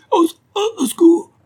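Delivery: very high, loud, choking wail; strangled cry for help. A narrator's reading. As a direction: squeal for help with the noose tightening. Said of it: "grogna Big". (appel au secours étranglé, très aigu)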